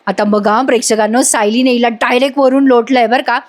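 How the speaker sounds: ordinary delivery, nothing marked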